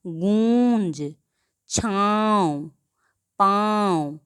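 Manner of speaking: गूंज छाँव पाँव slowed with vowels nasalised; nasalised vowels demo. Shown as a demonstration. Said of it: "नाक और गले दोनों की सहायता".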